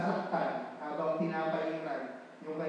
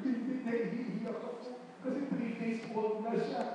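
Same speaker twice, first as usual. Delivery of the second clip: mock tearful; 8 semitones higher, slower than usual. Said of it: "sabi ko".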